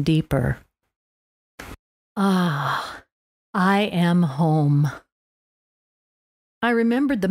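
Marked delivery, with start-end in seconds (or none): 2.1-4.9 relieved sigh. Real, not acted. Arriving home, deep relieved breath.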